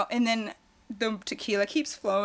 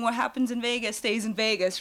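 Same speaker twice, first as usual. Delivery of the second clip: usual speaking voice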